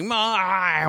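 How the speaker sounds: "My" high, two words mushed into one; blended words. Retold or imitated. Showing co-host how it sounded, "My" high and garbled. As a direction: mimic the garbled "My" with pitch thrown up. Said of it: "That's what it sounded like".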